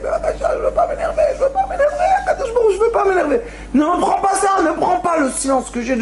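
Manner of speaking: high, cracking, tearful pleading with a laugh in it; half-laughing sob. Retold or imitated. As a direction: cry and laugh while begging God. Student's retold tearful prayer.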